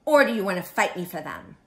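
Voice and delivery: loud, quick, grouchy challenge; brisk and pushy. Acted. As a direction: pick a fight in a storybook voice. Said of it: "screamed the grouchy ladybug".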